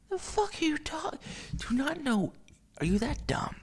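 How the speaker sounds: high-pitched, exasperated squeak of disbelief; mock incredulous. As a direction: ask it way up high, as if baffled by stupidity. Acted incredulous retort to her.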